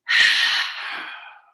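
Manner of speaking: loud rush of breath out; forceful pumped exhale. Shown as a demonstration. Yoga teacher demonstrating breath of fire slowly, forceful exhale. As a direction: push all the air out hard. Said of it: "and then you'll just".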